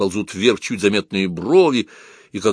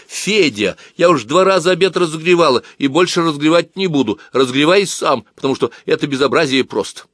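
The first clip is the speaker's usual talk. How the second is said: loud, higher scolding call from outside; calling out the window; 7 semitones higher, 5 dB louder than usual. A narrator's reading. Varya calls Fedya from outside, loud and annoyed. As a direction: scold him for the cold dinner, loudly. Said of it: "Варя за окном проговорила".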